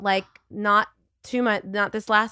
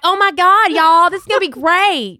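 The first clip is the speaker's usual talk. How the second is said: put-on Texas accent; 10 semitones higher, 10 dB louder than usual. Mocking her Texas sales team, hammed-up Texas accent. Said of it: "lapse into the accent".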